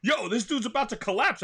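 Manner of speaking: loud, high-pitched mock alarm; alarmed reaction. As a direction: exclaim like a startled bystander. Acts alarmed reaction to slumping addict.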